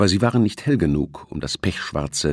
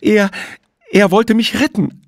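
high, loud anguished cry that cracks; voice breaking; 7 dB louder than usual. A narrator's reading. Juliette crying out over the railing about her rescuer, voice breaking. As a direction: voice breaking into a sob, much higher and louder. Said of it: "Ihre Stimme brach und ging in ein furchtbares Schluchzen über".

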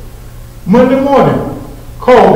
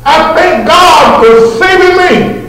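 shouted hallelujah; 8 semitones higher than usual. Shown as a demonstration. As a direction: shout it out to God.

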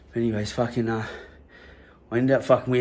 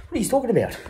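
much higher, fake surprise; mock innocence; 12 semitones higher, faster than usual. Teen feigning shock when police ask about the gun. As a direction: act innocent, overdone.

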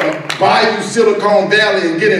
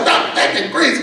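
high-pitched mock scolding; exasperated wife; 6 semitones higher than usual. Wife telling off her morbid husband, imitated high exasperation.

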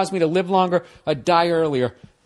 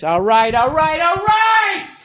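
drawn out, higher, slow exasperated all right; 10 semitones higher, slower than usual. Retold or imitated. Husband ending his wife's rant with a slow exasperated all right. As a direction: stretch the last all right to shut her up. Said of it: "It's basically this".

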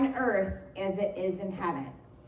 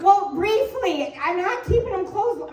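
sheepish excuse; 8 semitones higher, 6 dB louder than usual. Retold or imitated.

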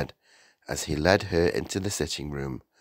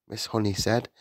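ordinary delivery, nothing marked

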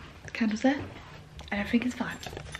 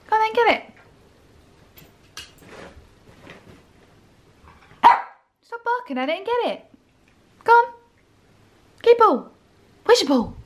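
excited dog talk; 11 semitones higher than usual. Real, not acted. Excitedly urging dog to play.

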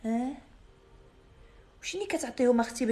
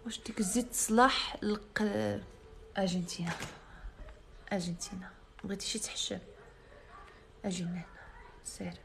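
whisper; 2 semitones lower, 10 dB quieter than usual. Real, not acted. Asks about the oil quietly.

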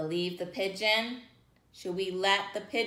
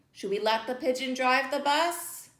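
ordinary delivery

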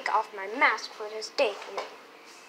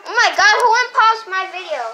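exasperated; 6 semitones higher, 8 dB louder, faster than usual. Real, not acted. Real annoyance at interruption.

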